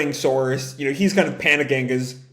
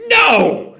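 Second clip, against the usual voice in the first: loud, higher flat refusal; emphatic no; 6 semitones higher, 7 dB louder than usual. Retold or imitated. Belle refusing Tangle's idea of going again, loud emphatic no. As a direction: shut the idea down hard. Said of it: "Belle's reaction".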